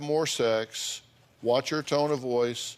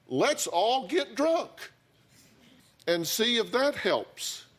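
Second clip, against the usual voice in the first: sarcastic suggestion; 10 semitones higher than usual. Acted. Mocking a couple's drinking.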